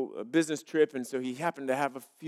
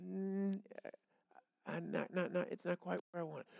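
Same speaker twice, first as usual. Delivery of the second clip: much softer, calm God voice; God answering; 2 semitones higher, 10 dB quieter than usual. Acted. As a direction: gently turn down the offer as God. God's voice answering the churchgoer.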